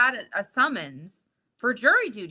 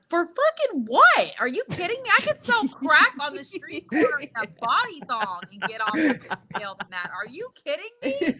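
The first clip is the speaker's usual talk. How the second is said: outraged yell; 6 semitones higher than usual. Retold or imitated. Wife outraged at husband's bail, high indignant yell. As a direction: blow up at the absurd bail amount.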